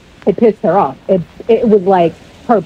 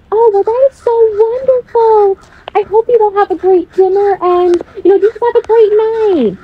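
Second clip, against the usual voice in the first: much higher, sugary mock-sweet imitation; fake sweet voice; 12 semitones higher than usual. Mimicking the girlfriend's fake kindness, high and sugary. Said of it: "All sweet and all kind".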